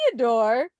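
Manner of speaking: normal talking voice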